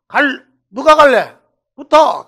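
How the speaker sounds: put-on rally the boys with a shout; calling the gang. Gang leader calling the boys to go.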